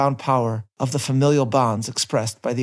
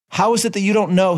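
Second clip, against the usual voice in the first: higher, slightly cross question; rather cross; 7 semitones higher, 4 dB louder than usual. Retold or imitated. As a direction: voice the old prisoner's mild irritation. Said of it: "he asked rather crossly".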